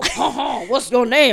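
comic French official with a nasal-style chuckle; put-on French accent, playful. Census man asking immigrants, hammed-up French accent. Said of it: "Is this your French accent".

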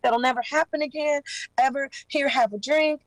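mild high imitation of the woman; voicing the woman. Narrator voices the woman comforting.

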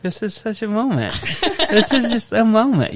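high, laughing with emotion; overwhelmed proud dad, half laughing; touched. Retold or imitated. Dad recalling his own thought at the sweet moment, high and moved. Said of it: "And I was just like".

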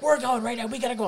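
the captain in a state, rushing; imitating panicked captain. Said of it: "He's like".

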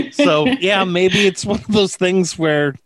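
ordinary delivery

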